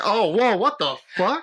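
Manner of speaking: high, startled outburst; mock shock. Retelling his reaction to his girlfriend, mock shocked. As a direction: act caught off guard. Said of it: "I was like".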